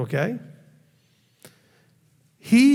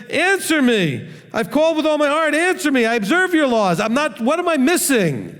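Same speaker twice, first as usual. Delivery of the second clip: confused plea; 9 semitones higher than usual. Retold or imitated. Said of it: "this is more the attitude he's having here".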